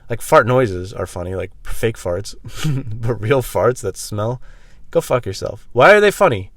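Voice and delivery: ordinary talk, nothing marked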